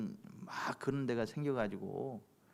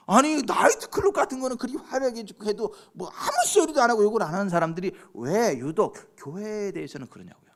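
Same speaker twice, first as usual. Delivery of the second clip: loud, high-pitched indignant questioning; impassioned preaching; 6 semitones higher, 9 dB louder than usual. Real, not acted. Preacher protests uproar over church building, loud indignant preaching. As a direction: fire exasperated rhetorical questions at the congregation.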